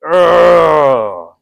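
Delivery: loud groan of real pain; real pained grunt. Real, not acted. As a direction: grunt hard from the hurt. Pain in hand.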